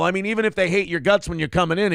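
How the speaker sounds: normal talking voice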